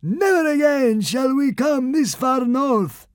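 high, slow, loud blubbering consul; sobbing plea. Acted. Consul sobbing as he offers his shield. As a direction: beg through sobs. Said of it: "he sobbed".

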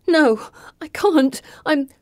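high-pitched, halting refusal; panicky. A narrator's reading. Heroine balking at the dark sewer, panicky. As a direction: refuse in rising dread.

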